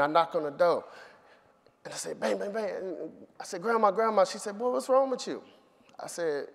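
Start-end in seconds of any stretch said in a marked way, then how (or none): 2.2-2.7 higher, voiced knock sounds; imitated knocking. Boy pounding on grandma's door, higher mock knocking. 3.6-4.2 loud, high calling grandma. Retold or imitated. Boy calling his grandmother through the door, loud high call. 4.6-5.4 much higher, startled grandma voice; grandma asking. Retold or imitated. Grandmother answering the frantic boy at the door, higher and startled.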